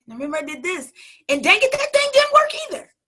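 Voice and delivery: speeding up and climbing to a high, loud cry; rising exasperation. Retold or imitated. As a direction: build from irritated to a shrill burst. Exasperated retold failures.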